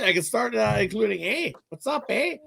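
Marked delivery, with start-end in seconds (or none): 1.7-2.4 hammed-up Canadian "eh". Canadian host offering "A" as the new drinking word, in a hammed-up high Canadian voice. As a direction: play up the Canadian "A" like a joke greeting. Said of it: "Being Canadian, I'll throw in A".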